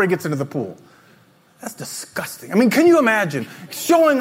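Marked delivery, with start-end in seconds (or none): 1.6-2.5 hushed, breathy mock disgust. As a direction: whisper it in fake disgust.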